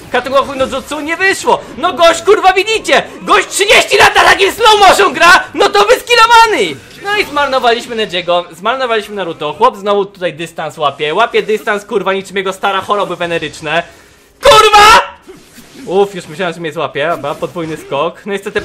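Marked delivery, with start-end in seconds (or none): 0.1-6.8 high, loud exasperated outburst; worked-up ranting. Real, not acted. Mocking an opponent, worked up. 14.4-15.0 sudden high, loud outburst; shouted curse. Real, not acted. Curses at the game.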